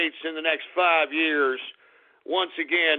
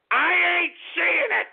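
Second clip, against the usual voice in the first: indignant outburst; 12 semitones higher, 4 dB louder than usual. Real, not acted. First of repeated line, high and loud.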